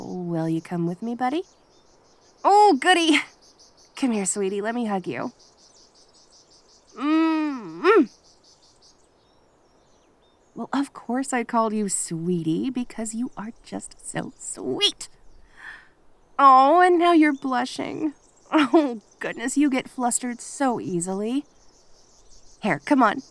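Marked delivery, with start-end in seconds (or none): 2.4-3.2 high and loud little cheer; delighted. Acted. Kitsune delighted her friend agrees, high bright cheer. 16.4-21.4 teasing. Acted. Kitsune teasing her blushing friend, slow playful tease.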